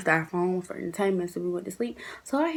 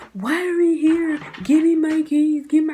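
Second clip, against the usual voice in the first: mock drunk demand; 8 semitones higher, 7 dB louder than usual. Drunk roommate yelling for her keys at the dorm, loud whine.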